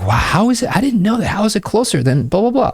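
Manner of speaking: imitating the girl amazed by his geography. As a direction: gush like she can't believe it.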